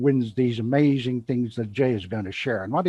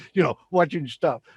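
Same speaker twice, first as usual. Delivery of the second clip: ordinary delivery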